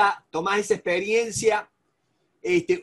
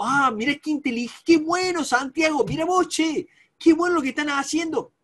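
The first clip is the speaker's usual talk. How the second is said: higher-pitched, impressed praise; butter them up with admiring surprise; admiring interest; 6 semitones higher than usual. Shown as a demonstration. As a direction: act delighted by what they're doing. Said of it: "si yo quiero ser inteligente".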